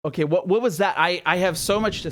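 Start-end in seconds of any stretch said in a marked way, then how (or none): none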